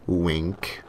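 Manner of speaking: ordinary delivery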